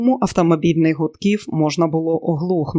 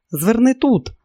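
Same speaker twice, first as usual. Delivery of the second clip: higher-pitched, excited direction; 6 semitones higher, slower than usual. A narrator's reading. Pierra gives Robert directions in the car, excited. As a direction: read it with a mild burst of excitement. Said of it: "збуджено сказала П'єра".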